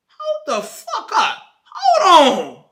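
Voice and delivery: slow, high, loud disbelief; drawn-out mock outrage, hammed-up; shocked outburst. High man finding out he's cheated on, shocked outburst. Acts out man's shock at email.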